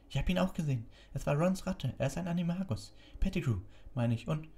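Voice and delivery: high-pitched eager witness; earnest pleading girl. Acted. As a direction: back up your friend urgently. Figure voice of pleading Hermine. (eindringlich flehendes Mädchen, hoch)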